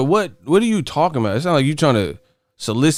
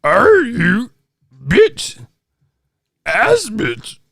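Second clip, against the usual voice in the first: Yoda; 8 semitones higher, much slower than usual. Retold or imitated. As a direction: squawk the chat message in a mock alien-sage voice. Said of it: "these motherfuckers talking like Yoda".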